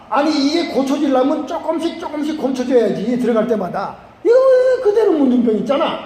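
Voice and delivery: hammed-up high, loud complaint; angry again. General still seeing leprosy after each dip, acted anger. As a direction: rant indignantly, rising to a high outcry. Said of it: "그래서 막 화를 또 내는 거야".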